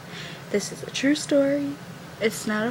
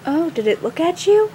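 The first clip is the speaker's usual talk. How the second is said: teasing; 6 semitones higher, 5 dB louder than usual. Retold or imitated. Frida teasing the screamer.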